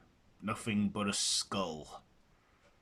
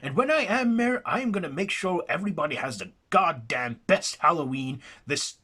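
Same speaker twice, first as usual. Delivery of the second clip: high, loud, worked-up pledge; rally the town like a stump speech; rousing boast; 7 semitones higher, 6 dB louder, faster than usual. Acted. The local promising Jackson a funded carnival, fired up. The local getting carried away.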